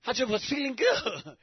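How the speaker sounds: ordinary talk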